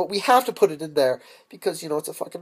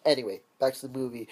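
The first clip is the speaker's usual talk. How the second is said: usual speaking voice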